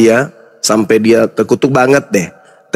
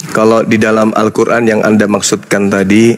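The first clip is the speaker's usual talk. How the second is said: usual speaking voice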